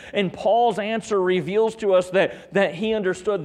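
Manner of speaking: high, loud, driving sermon voice; fervent preaching. Real, not acted. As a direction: drive the point home from the pulpit. Preaches the jailer's question.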